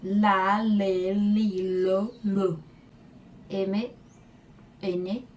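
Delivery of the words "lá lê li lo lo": "Lá" "lê" "li" "lo" with a heavy, overdone l; exaggerated L. Shown as a demonstration. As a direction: lean hard into each l. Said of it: "exagerar bem no seu l".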